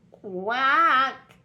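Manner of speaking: high, loud startled quack as the mother duck tumbles back; alarmed duck quack. A narrator's reading. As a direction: squawk it like a frightened duck. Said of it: "went Missus Mallard as she tumbled back again".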